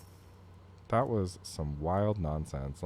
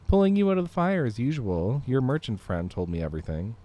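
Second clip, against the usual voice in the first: put-on Canadian accent; 5 semitones higher, 7 dB louder than usual. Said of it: "I can use a regular Canadian accent for him".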